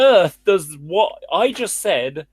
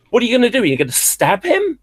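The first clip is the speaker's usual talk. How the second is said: normal talking voice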